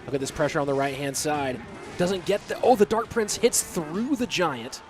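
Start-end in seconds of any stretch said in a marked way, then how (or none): none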